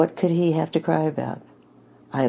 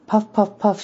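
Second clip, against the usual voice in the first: put-on childlike puffing; 9 semitones higher, 3 dB louder than usual. Little girl imitates the steamer.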